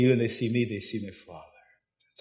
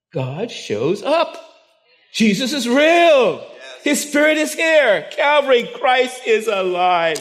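louder, higher, slowed preaching proclamation; preaching at full force; 10 semitones higher, 9 dB louder, slower than usual. Acted. Preacher to Calvary congregation, rising preaching climax. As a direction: proclaim it like the peak of the sermon.

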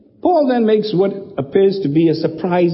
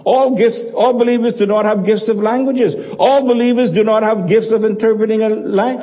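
higher, measured sermon delivery, each line hammered; preaching; 4 semitones higher than usual. Acted. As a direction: lay down each point from the pulpit. Emphatic paraphrase list, preached.

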